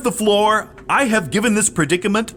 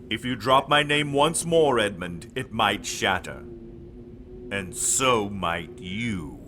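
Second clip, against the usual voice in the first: menacing Dark Lord; 12 semitones lower, 6 dB quieter than usual. Acted. Dark Lord threatens Edmund.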